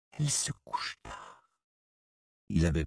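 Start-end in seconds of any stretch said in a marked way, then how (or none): 0.1-1.5 low, soft, near-whispered words; hushed aside. A narrator's reading. Boy to narrator about the old women, hushed. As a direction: keep it low and secret.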